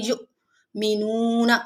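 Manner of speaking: युमिनूना slow, a light catch at the hamza after यु; light jerk on hamza. Shown as a demonstration. Teacher demonstrating sakin hamza in युमिनूना. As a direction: recite युमिनूना with a soft stop. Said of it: "हल्के से झटके के साथ".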